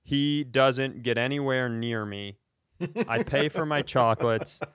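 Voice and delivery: ordinary talk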